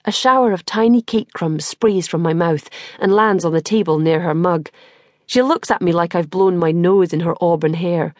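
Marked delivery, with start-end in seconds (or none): none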